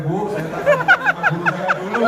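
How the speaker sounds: put-on flustered young man laughing his way out; nervous laugh while refusing; laughing reply.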